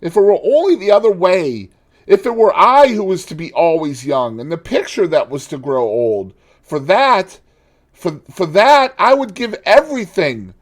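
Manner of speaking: high, loud despairing cry; anguished lament. Acted. Dorian lamenting to the painter, high and anguished. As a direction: pour out his despair, pleading.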